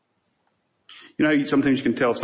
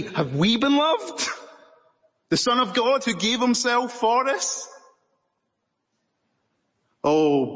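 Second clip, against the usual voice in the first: rising, much higher, slower impassioned appeal; preacher pleading with the church, much higher and impassioned; impassioned preaching; 7 semitones higher, slower than usual. Acted. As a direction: lift it up and plead with the congregation. Preaching climax.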